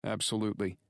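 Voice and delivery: steady, blunt partner; gruff friend voice. Acted. Drake's voice.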